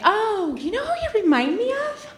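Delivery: high, bright curious question; mock eager high voice. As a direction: ask it like a big reveal. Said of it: "they're like".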